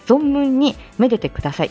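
ordinary talk

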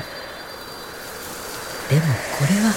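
a very slow, whispered aside; hushed slow whisper. A narrator's reading. The narrator remembering a gentler wind, in a slow whisper. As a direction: drop to a near-silent, lingering whisper.

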